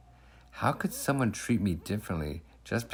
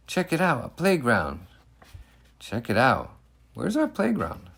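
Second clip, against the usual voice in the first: put-on wondering kids; 6 semitones higher than usual.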